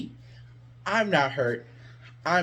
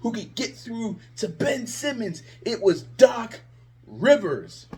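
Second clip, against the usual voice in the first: excited hype; 5 semitones higher than usual. Retold or imitated. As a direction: hype up the new coach. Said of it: "I was like".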